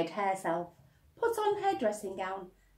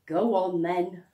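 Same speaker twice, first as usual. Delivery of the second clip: sighing; 3 semitones higher, 9 dB louder than usual. Acted. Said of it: "sighed Missus Large".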